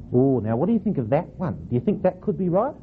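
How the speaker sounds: ordinary delivery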